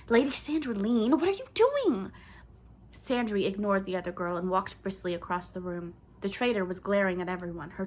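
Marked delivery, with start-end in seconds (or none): 0.1-2.1 cutting voice. A narrator's reading. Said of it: "Lisa's voice cut through".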